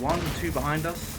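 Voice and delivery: high, loud urgent warning; loud callout. Real, not acted. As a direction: shout the warning to the team. Callout during firefight.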